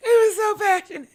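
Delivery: high, quick, crying voice; tearful squeal. Acted. Same words repeated, breaking up.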